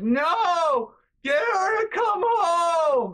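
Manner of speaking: high, loud crying wail, dragged out slowly; mock-sob the demand like a toddler. Sister wailing down the phone for mom to come home. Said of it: "And she's like".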